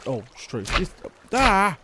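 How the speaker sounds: ordinary talk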